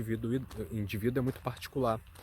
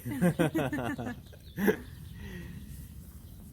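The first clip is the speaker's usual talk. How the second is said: delighted laugh; 8 semitones higher than usual. Real, not acted. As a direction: laugh fondly at the little one. Laughs at child's greeting. (risada encantada e aguda)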